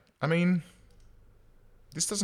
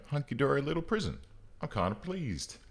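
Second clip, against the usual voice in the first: ordinary delivery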